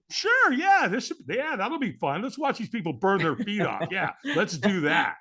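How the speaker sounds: high, mock-keen agreement; sarcastic enthusiasm. Firewalker inwardly answering the invitation to watch, sarcastic enthusiasm. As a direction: play along with heavy sarcasm.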